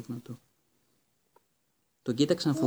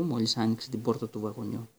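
ordinary delivery

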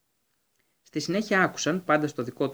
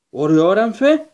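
loud, slow, drawn-out cry of a name; calling out in relief; hoarse exclamation; 6 semitones higher, 11 dB louder, slower than usual. A narrator's reading. Hagrid crying out for his escaped hippogriff, loud and drawn out. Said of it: "αναφώνησε βραχνά ο Χάγκριτ".

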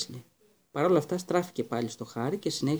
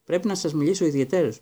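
ordinary delivery

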